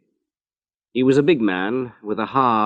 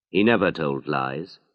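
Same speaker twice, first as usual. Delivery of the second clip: plain reading voice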